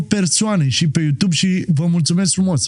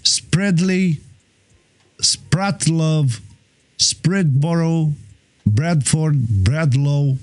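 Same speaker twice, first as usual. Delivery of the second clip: American accent; 2 semitones lower, much slower than usual. Shown as a demonstration. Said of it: "Ia și pronunță".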